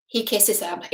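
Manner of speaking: ordinary talk, nothing marked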